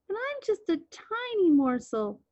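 high, pleading goat voice; small, high-pitched creature; little billy goat. A narrator's reading. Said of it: "said the billy goat".

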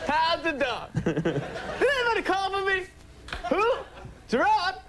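very high, strident comedian voice; hammed-up high-pitched comic impression; Chris Rock. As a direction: push it high and punchy, stand-up style. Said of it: "have to talk like Chris Rock".

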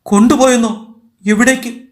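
shocked questioning. A narrator's reading. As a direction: ask in stunned disbelief. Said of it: "Meera നടുങ്ങിപ്പോയി".

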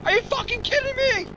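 high, loud, fast ranting in real anger; furious outburst. Real, not acted. Streamer raging at having to walk all the way back, high furious rant. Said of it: "I'm so upset right now".

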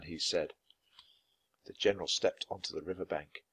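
ordinary delivery

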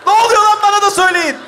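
loud high demand; high shout, easing slightly; calling out. Acted. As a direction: shout it across the bus. Calls out demanding to be told.

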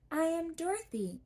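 put-on a polite girl introducing herself; young girl Dorothy. A narrator's reading.

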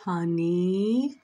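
long rising call from a low start; channeled father calling. Acted. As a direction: stretch it upward, calling to a daughter. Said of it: "He's like".

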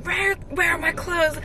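high, frantic mock demand; retold yelling. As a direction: burst in demanding an answer. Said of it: "I was just still yelling at her".

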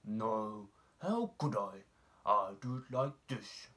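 a touch of a big horse's denial; horse answering. A narrator's reading. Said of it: "who was grazing in the pasture".

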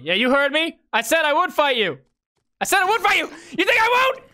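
playful bravado, getting worked up; mock fight-picking. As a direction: challenge building in pitch to a shout. Picking a fight with game opponent.

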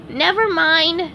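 high, slow cartoon dismissal; SpongeBob giving up. A narrator's reading. SpongeBob drops the correction with his friend, dismissive. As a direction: draw it out in a high voice. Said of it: "said SpongeBob".

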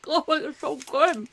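laughing then talking through a bite of chocolate; guilty pleasure while chewing; mouth full. Real, not acted. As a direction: savour it slowly with your mouth full. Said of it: "I can't talk".

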